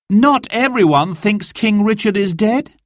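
high, hopeful lady's voice; gently disagree; a lady contradicting the sheriff; lady at dinner. Acted.

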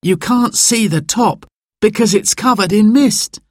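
put-on high-pitched boy, full of wonder; child's voice. Simon gazing at the misty summit, child's high voice. Boy explaining what he sees.

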